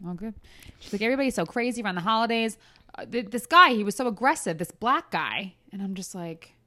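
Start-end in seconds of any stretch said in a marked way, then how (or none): 1.0-5.5 put-on fast, higher New Jersey accent gossip. Aide complaining about Costco shoppers in a Jersey accent. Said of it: "I'm putting my real Jersey accent on for her".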